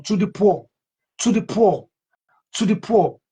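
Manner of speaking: "poor" hammered three times, rising; preaching repetition. Shown as a demonstration. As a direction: drive home "the poor" each time. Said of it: "Emphasis on the poor".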